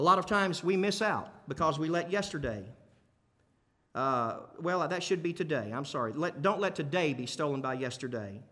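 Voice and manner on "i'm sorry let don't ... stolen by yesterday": ordinary talk, nothing marked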